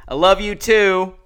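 loud, drawn-out imitation of calling back; called-out retort. Biker riding off from a screaming fan, loud mock call back.

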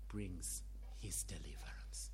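whisper. Acted. Sermon, hushed on deliverance.

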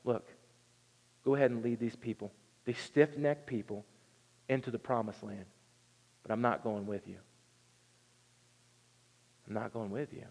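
God quietly withdrawing his presence; hushed stern refusal; solemn God. Retold or imitated. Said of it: "God is like".

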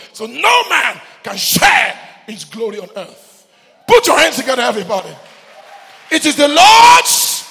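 shouted, high, driving sermon; fiery preacher roaring at the church; preaching at full force. Acted. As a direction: preach it to the back row. Preaching climax, shouting to congregation.

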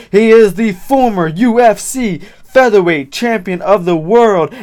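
loud, high, drawn-out ring announcer; boxing ring announcer. Acted. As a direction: build each name to a booming call. Announces a title fight intro.

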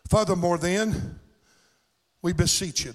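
Furthermore, then, we beseech you read slow and deliberate; read slowly. Shown as a demonstration. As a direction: take your time over each word of the scripture. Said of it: "I'll read it kinda slow".

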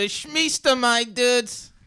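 slow, high, loud dude impression; drawn out like a silly bro; goofy dude voice. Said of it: "we would walk around the house going".

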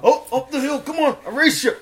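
high, excited stoner impression; Seth Rogen. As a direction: egg a friend on, loudly. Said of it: "Can you do Seth Rogen?".

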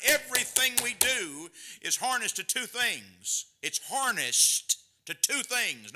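loud, high pulpit preaching; preaching at full force. Acted. Preacher rallying the churches about Jesus, loud and high. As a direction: drive it home to the congregation. Preacher builds to his point.

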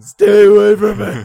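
put-on high, loud old person shriek. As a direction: screech it at them. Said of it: "I'll just shriek at people".

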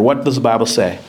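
usual speaking voice

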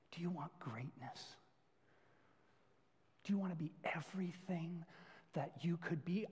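intense whispered challenge; a coach whispering to a recruit; coach's whisper. Acted.

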